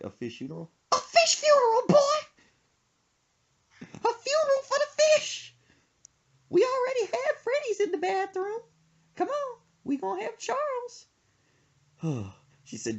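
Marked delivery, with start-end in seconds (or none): 0.9-11.1 high, loud falsetto scolding; mom mad. Retold or imitated. Mom scolding her son about her fish funeral, high loud. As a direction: snap at him in a high mom voice. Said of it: "She got mad".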